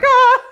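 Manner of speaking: high, loud mock scream; startled yelp. Driver startled by the cop not hearing her, panicked yelp. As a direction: yelp as if jolted by the officer.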